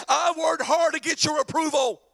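high, shouted grievance; indignant son demanding approval; mad older son. Acted. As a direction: hammer each complaint at your father. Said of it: "let me tell you what he said".

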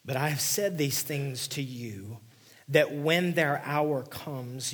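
loud, slow, ringing proclamation; preacher thundering the verse; forceful scripture reading. Acted. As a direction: read it out like a prophecy.

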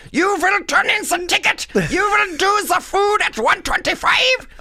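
put-on loud, very high German accent on stage; hammed-up German barking out orders. Comic mocking the lunch lady on stage, shouted German accent. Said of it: "like, a German accent".

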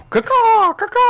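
high screeching crow caw; shrill bird caw. Pretend. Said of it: "That's how I snore".

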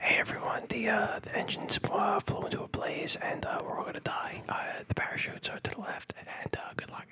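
whispered cabin announcement; hushed doom briefing; ASMR pilot announcement. Pretend. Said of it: "That's the A S M R airline".

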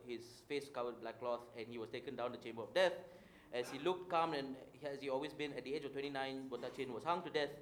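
low, grave storyteller close; dramatic story narration. Acted. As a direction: tell the ending like a true-crime host.

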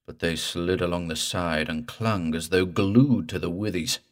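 ordinary delivery, nothing marked

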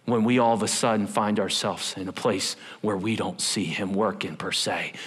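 ordinary delivery

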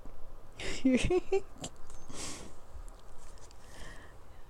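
giggle. Real, not acted. Giggles at her own stumbling.

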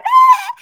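very high, loud mock child squeal; imitated playful squeal. Mom describing babies playing wildly before bedtime, high imitated squeal. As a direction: squeal like a baby mid-play.

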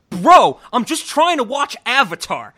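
high, loud fed-up yell; imitating an angry outburst. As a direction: mimic him blowing up at the remote. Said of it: "throws it across the room and he goes".

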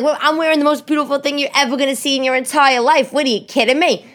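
high-pitched, indignant comic retort; mock indignation. Wife answers her husband's slight, hammed-up indignation. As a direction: push it high in one outraged run.

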